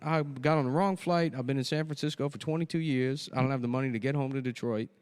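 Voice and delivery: comic confused fake investor; put-on investor voice. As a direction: start high and hapless, ease down while explaining. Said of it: "why is he talk that way".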